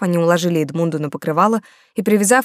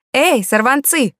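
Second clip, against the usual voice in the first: put-on slow, high call to the kids; 7 semitones higher, 3 dB louder, slower than usual. A narrator's reading. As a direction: call them over from afar. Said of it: "крикнула я".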